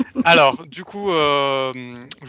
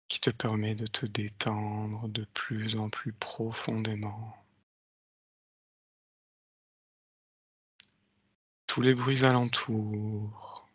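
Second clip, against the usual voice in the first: slow, soft hypnotic countdown; slow hypnotist voice; 3 semitones lower, 6 dB quieter, much slower than usual. Acted. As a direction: lull her deeper, slowly. Leads a hypnotic induction. (voix lente d'hypnotiseur)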